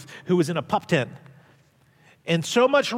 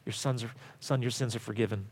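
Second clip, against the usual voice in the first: much softer, lower, gentle; hushed quote; 10 semitones lower, 12 dB quieter than usual. Shown as a demonstration. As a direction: say it as a tender hush. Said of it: "and Jesus says".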